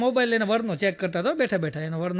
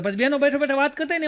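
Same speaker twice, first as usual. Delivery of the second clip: ordinary delivery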